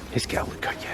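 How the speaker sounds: soft whisper to the bird; whispered to a hawk. Real, not acted. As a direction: whisper so you don't startle it. Leaning in to see a hawk.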